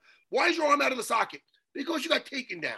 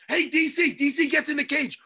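ordinary delivery

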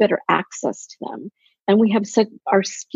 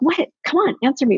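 higher-pitched mock impatience; impatient wife; 7 semitones higher than usual. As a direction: playfully badger a husband for a reply. Acting out impatience with silent husband.

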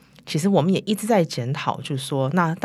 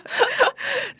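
laughing higher through the words; 12 semitones higher, 4 dB louder, faster than usual. Real, not acted. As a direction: giggle out the brave admission. Laughs about first studio visit.

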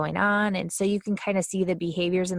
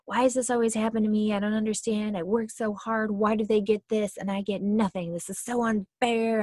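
higher-pitched woe-is-me complaint; victim mode; 4 semitones higher than usual. Acted. Acting out someone stuck in victim mode, mock self-pitying whine. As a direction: play up the hard-done-by victim. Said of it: "victim mode where they kind of fall into victim".

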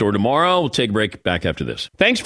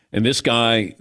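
usual speaking voice

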